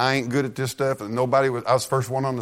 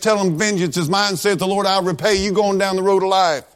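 higher, louder revival preaching cadence; full-force preaching; 4 semitones higher, 3 dB louder than usual. Acted. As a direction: drive each line home to the congregation. Preaching rising run.

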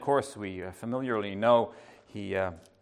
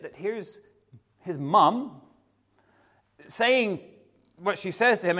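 normal talking voice

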